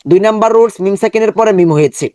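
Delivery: normal talking voice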